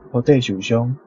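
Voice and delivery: ordinary delivery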